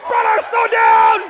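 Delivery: loud, high, drawn-out yell; yelling in his ear. Retold or imitated. Into his brother's ear at top speed, slow loud yell.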